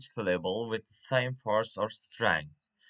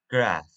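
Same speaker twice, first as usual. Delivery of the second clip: "graph" said alone and louder, as a stressed syllable; 4 semitones lower, 6 dB louder than usual. Shown as a demonstration. Teacher isolating the third syllable of photographic, "graph" stressed hard. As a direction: punch "graph" out on its own. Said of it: "the stress at, uh, third syllable".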